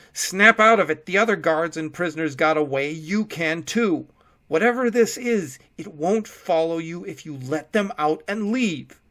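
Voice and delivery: screaming. A narrator's reading. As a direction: scream him out of his freeze. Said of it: "Will screamed at him".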